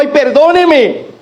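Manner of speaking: high, slow, mock-pleading beg; a debtor begging mercy, drawn out high. Debtor begging the creditors, high, slow plea.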